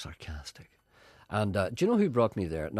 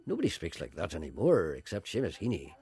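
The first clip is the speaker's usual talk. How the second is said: ordinary talk, nothing marked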